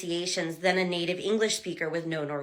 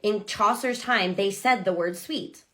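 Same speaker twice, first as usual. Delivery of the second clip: usual speaking voice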